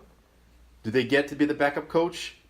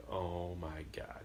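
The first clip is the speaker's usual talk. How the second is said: slow whisper of dread; mortified whisper; 5 semitones lower, 14 dB quieter, much slower than usual. Retold or imitated. Son dreading dad as coach, mortified whisper. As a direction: whisper it under your breath, drawn out.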